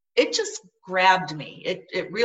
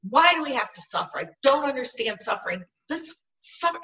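retold angry outburst, higher and quick to start; raised-pitch, exasperated protest; mad at God; 6 semitones higher, 3 dB louder than usual. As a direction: re-enact a heated demand to God. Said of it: "And I was mad".

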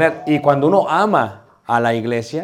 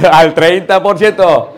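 loud, laughing repeat, stretched out slowly; 3 semitones higher, 8 dB louder, slower than usual. Real, not acted. Teacher amused by his own English lesson, laughing loudly. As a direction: say it louder through real laughter.

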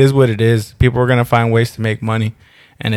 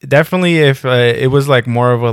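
ordinary talk, nothing marked

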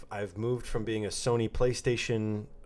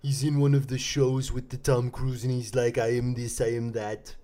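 Werner Herzog; 2 semitones higher, 4 dB louder than usual. Acted. Showing off an impression to a co-host, German-accented narrator. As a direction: play the German narrator with relish. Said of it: "It's a really good impression".

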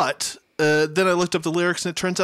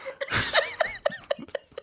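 squealing high laugh; 7 dB quieter than usual. Real, not acted. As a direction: burst into a shrieking laugh. Laughing hard.

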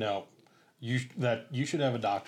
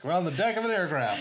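mock announcement; 7 semitones higher, 4 dB louder than usual. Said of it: "we're like".

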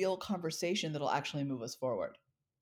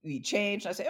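usual speaking voice

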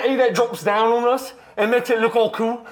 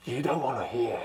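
ominous hush; 13 semitones lower, 12 dB quieter than usual. Acted. Hinting at dark past.

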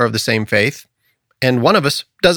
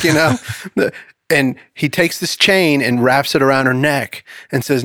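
normal talking voice

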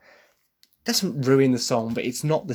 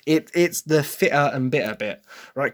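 usual speaking voice

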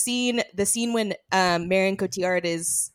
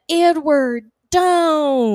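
higher, louder quoted plea; a hint of dramatic pleading; quoting the actress; 7 semitones higher, 7 dB louder, much slower than usual. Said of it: "That pan to the camera".

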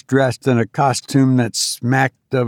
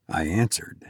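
ordinary delivery

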